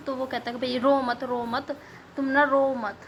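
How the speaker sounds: ordinary delivery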